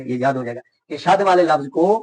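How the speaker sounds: normal talking voice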